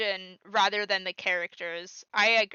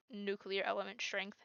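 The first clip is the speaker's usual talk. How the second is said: ordinary delivery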